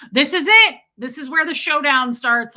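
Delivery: loud, high start settling slightly; spoiling for a fight. Retold or imitated. Fed-up shopper bracing for a showdown, loud mock-angry. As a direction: declare battle, hammed up. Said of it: "ready to bite this lady's head off".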